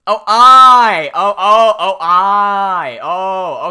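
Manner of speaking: very loud, drawn-out mock "oh, I see"; exaggerated realisation. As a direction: shout it out as the penny drops. Retold sudden realisation of the key.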